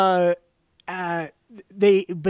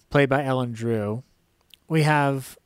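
ordinary talk

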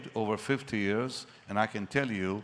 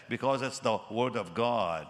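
normal talking voice

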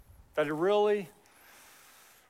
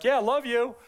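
high, loud, hurried throwaway I love you; rushed brush-off; 8 semitones higher, 6 dB louder than usual. Shown as a demonstration. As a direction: toss it off on the way out the door. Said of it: "I'm in a hurry and it's just like".

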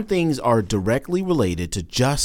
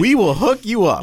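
mock studio rep; 6 semitones higher, 3 dB louder than usual. Mocking studio people bribing Smith.

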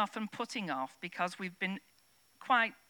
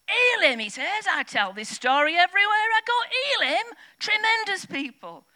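much higher and louder, bursting with enthusiasm; imitating the glazier's cheery delight; cheery excited; 13 semitones higher, 11 dB louder than usual. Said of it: "really cheery".

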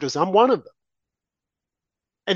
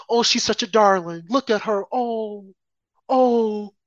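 mock cooing; 3 semitones higher, slower than usual. Mocking media cooing over her.

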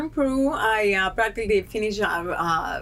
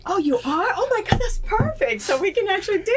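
much higher, thrilled gushing; excited; 10 semitones higher than usual. Retold or imitated. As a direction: gush like it's the best news ever. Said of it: "so I'm like".

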